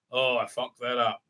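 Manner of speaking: low, soft mock sulk; mock pouty. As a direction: sulk it quietly, as a put-on pout. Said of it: "like, all pouty".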